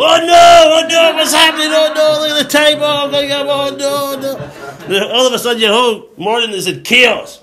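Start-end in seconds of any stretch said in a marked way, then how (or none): 0.0-4.9 high, loud wail of oversleeping; hammed-up frantic cries, fading into laughter; mock panic. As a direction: play someone waking an hour late. Acts waking up late in panic.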